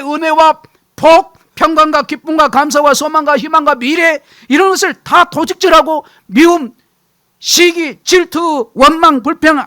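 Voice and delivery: loud, high impassioned sermon; preacher building to full force; fiery preaching. Acted. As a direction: thunder the list of sins.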